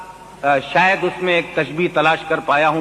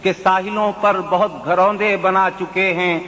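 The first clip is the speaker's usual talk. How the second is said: poetry recitation; 6 semitones higher than usual. Acted. Mushaira recitation of ghazal.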